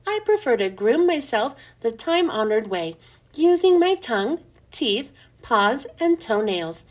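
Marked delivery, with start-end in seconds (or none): none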